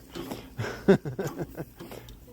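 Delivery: airy chuckle; breathy laugh. Real, not acted. Laughs at own aside.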